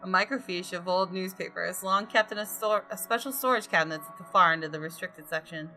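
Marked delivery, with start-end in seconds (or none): none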